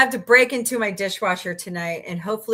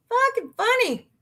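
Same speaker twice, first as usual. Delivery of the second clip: "Fucking funny" squeaked much higher; high repeat; 14 semitones higher than usual. Shown as a demonstration.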